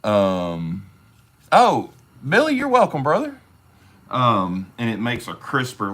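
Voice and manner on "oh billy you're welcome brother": happy greeting. Real, not acted. Greeting viewer on live stream.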